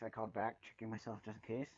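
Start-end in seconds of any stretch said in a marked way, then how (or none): none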